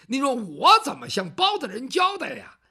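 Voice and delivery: a servant scolding loudly in despair; exasperated plea. Acted. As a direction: throw up your hands in protest.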